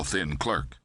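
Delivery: ordinary delivery, nothing marked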